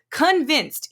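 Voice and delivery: "convinced" hit high and loud; stress on convinced. Real, not acted. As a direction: punch the repeated "convinced". Repeats word for emphasis.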